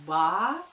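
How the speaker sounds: spelled in syllables; dictating. Shown as a demonstration. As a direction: say «Ба-лет» in two clear separate syllables. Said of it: "Пишу". (диктует по слогам)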